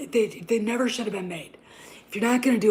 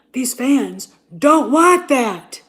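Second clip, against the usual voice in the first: shouted, slow and much louder; 5 semitones higher, 12 dB louder, much slower than usual. Real, not acted. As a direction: drag it out and bellow it. Ranting about what fans want.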